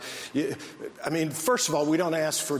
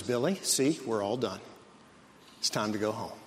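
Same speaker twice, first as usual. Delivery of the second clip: lower, softer soothing dad voice; calm dad; 6 semitones lower, 5 dB quieter than usual. Retold or imitated. Dad calmly reassuring his son Billy in the store. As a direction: soothe a fussy kid evenly. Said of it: "Dad is as calm as a cucumber".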